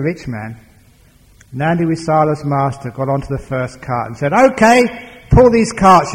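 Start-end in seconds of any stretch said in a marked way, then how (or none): 4.3-6.1 loud, high bellowed order; shouted command. Acted. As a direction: call it out hard to get the beast moving. Master ordering ox to pull.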